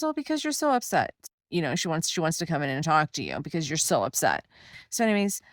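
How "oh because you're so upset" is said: much higher, louder singsong; high, sugary nurse voice brushing off worry; mock sweet nurse. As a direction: imitate her sugary brush-off, quick.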